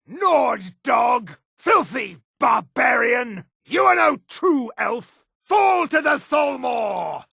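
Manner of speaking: high, shouted contempt; slow, drawn-out shouts of scorn at an enemy; battle insults. Acted.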